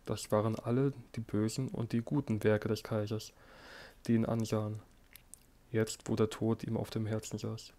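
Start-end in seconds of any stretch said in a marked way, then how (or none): none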